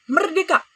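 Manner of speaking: "Merdeka" high and loud, a strong ringing cry; strong rising intonation. Shown as a demonstration. Teacher to pupils, rousing, high "Merdeka". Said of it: "intonasi yang kuat".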